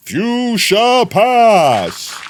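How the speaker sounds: slow, high, loud proclamation; stretched loud call; drawn-out shout. Pretend. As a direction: announce it like a grand title.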